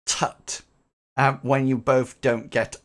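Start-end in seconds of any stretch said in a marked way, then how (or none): 0.0-0.6 a tiny disapproving tongue click; faint tut. Acted. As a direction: click the tongue softly, unimpressed. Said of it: "gives a very faint tut".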